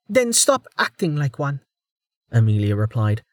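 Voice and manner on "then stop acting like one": heated. Acted. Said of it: "just as hotly".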